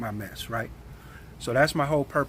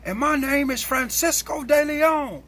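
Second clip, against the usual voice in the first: high, slow, pompous mocking voice; coworker's grand reply; 10 semitones higher, 4 dB louder, slower than usual. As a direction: mimic him proudly naming himself. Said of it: "He said".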